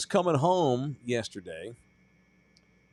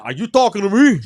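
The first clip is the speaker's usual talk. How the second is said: loud, high-pitched confrontational mock version; exaggerated tough challenge; 8 semitones higher, 11 dB louder than usual. Shown as a demonstration. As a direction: overplay it as a hard challenge. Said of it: "But I didn't say it all like".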